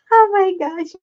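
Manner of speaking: words breaking into tearful laughter; real helpless giggles; crying laughing. Real, not acted. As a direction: gasp it out, mortified and cracking up. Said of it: "I am crying".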